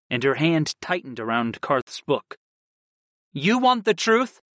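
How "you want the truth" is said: sharp tone. A narrator's reading. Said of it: "Kristen answered in a sharp tone".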